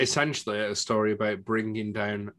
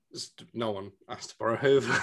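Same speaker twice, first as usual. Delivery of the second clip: ordinary delivery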